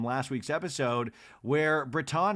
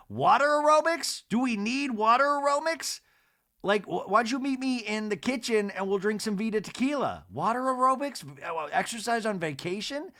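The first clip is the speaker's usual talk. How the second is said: much higher, disbelieving; incredulous; 8 semitones higher than usual. Real, not acted. Reacting to the water aerobics invite, incredulous. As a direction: scoff at the idea, pitched up. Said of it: "incredulous mocking".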